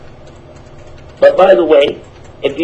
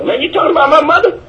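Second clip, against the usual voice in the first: high, fast indignant retort; hammed-up touchy comeback; mock offended reply; 9 semitones higher, faster than usual. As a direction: bristle at the insult about mother.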